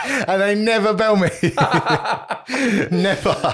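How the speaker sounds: high, laughing through the words. Real, not acted. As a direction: crack up while saying it. Laughing at own story.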